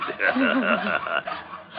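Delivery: high gloating chuckle; mad laugh. Acted. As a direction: laugh crazily to yourself. Madman pleased with captive's work.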